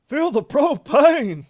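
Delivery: high, loud, drawn-out battle cry; Mecha Hank Hill. Acted. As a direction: bellow it slowly up high like a cartoon dad turned mech. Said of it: "here's Mecha Hank Hill".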